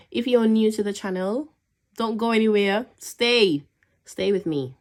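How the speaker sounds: eager outro plea. Real, not acted. Vlog outro pleading to viewers.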